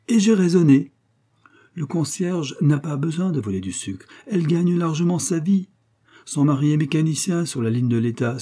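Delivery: pompous amateur detective. Acted. Coquille overwhelms his friend with his reasoning, smug and emphatic. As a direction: crush the listener with superior logic.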